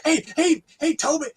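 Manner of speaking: high, pleading comic voice; Dave Chappelle impression. Friend on a call doing Dave Chappelle's whipping sketch in a high voice. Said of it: "does very much sound like Dave Chappelle".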